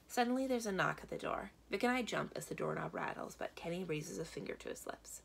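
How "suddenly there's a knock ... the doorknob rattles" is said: usual speaking voice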